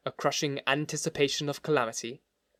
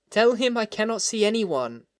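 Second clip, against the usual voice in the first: high, plaintive refusal; complaining; 8 semitones higher, 3 dB louder than usual. A narrator's reading. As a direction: complain in a raised pitch, wanting to be left alone. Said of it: "it said complainingly".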